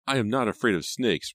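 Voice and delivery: a hint of boyish bravado; boyish declaration. A narrator's reading. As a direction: declare it as a brave young son. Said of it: "Brandon declared".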